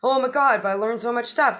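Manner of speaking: gushing. Acted. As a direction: spill it like a proud kid. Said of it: "It's like".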